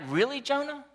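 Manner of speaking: high, disbelieving voice of God; God asking in exasperated disbelief; God asking Jonah in high mock disbelief; incredulous God. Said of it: "God is like".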